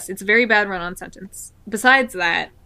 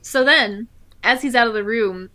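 normal talking voice

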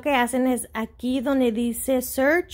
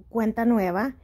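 ordinary talk, nothing marked

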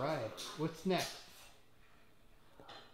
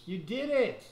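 excited praise; 5 semitones higher, 7 dB louder than usual. Real, not acted. Praising toddler's puzzle success.

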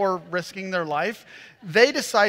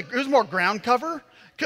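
ordinary delivery

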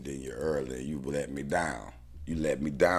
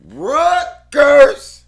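much louder, slow, then a very high shouted Rutgers; loud drawn-out cry; 21 dB louder, much slower than usual. Real, not acted. Bettor hyping Rutgers, loud high drawn cry. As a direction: call the team name out big.

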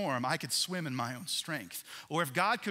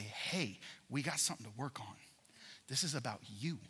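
softer and lower, a hushed gentle voice; still small voice; 4 semitones lower, 7 dB quieter than usual. Acted. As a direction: speak it like God's whisper in the storm. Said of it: "his still small voice say".

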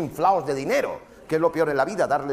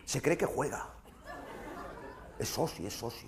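normal talking voice

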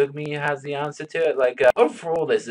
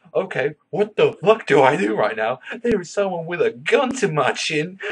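panicked Raku; 5 semitones higher, 5 dB louder than usual. Retold or imitated. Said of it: "He's like".

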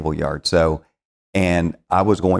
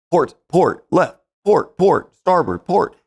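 high, loud, repeated commands; drill sergeant; 12 semitones higher, 4 dB louder, slower than usual. Retold or imitated. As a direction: fire off orders like a drill sergeant. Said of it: "he was like a drill sergeant".

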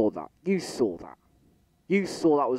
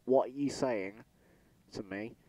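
ordinary talk, nothing marked

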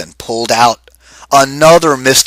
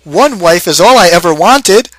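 higher, louder mock complaint; moaning husband; 7 semitones higher, 6 dB louder than usual. Husband complaining he only wanted one wife, hammed-up moan. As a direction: lament it to the heavens.

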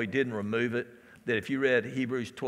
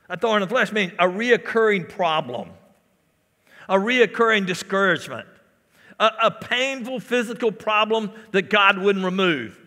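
raised, driving sermon delivery; fervent pulpit voice, higher and louder; full-force preaching; 8 semitones higher, 9 dB louder than usual. Real, not acted. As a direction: preach it loud, punching the key words.

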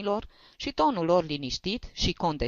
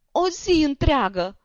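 "o zi întreagă" wailed higher and louder, slowly, weight on "zi"; sobbing lament; 11 semitones higher, 6 dB louder than usual. A narrator's reading. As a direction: repeat "o zi întreagă" through tears, now leaning on "zi". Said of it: "izbucni din pieptul lui un hohot de plâns".

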